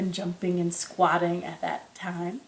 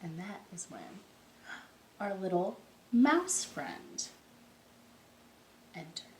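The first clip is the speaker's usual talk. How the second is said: softer, slow bedtime narration; hushed storytelling; 7 dB quieter than usual. A narrator's reading. Teacher reading a folk tale to the children, hushed.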